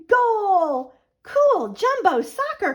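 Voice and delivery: put-on high, loud kid's shout; excited cry. A boy on the playground cheering the goal: loud high shout. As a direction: yell it like a thrilled boy on the playground. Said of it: "cried a boy".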